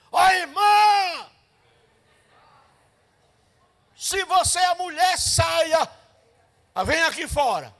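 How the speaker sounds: high, loud shouted call at the door; shouting woman. Retold or imitated. Angry woman shouting at the sister's door. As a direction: yell it out to draw her outside. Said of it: "foi não, assim".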